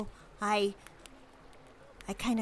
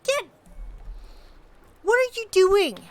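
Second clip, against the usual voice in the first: loud, much higher alarmed cry; panicked shout at the party; shocked shout; 12 semitones higher, 13 dB louder than usual. Acted. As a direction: yell it in disbelief. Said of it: "she goes".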